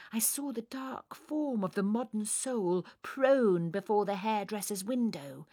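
usual speaking voice

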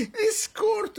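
high, slow words through laughter; scornful laughter. Acted. Prime minister mocking the envoy's court, laughing.

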